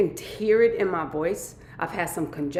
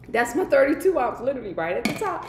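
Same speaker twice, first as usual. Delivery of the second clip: higher and louder, proud reveal; excited show-off; 7 semitones higher, 4 dB louder than usual. Real, not acted. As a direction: show it off with glee. Showing off the jar.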